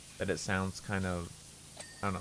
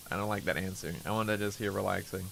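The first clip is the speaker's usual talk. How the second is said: ordinary delivery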